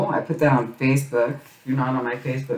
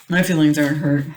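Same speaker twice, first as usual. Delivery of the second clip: "My feelings aren't hurt" repeated louder, hammed-up protest; mock insistent; 3 semitones higher, 6 dB louder, faster than usual. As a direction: insist playfully, overdoing the denial. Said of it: "No, it's fine".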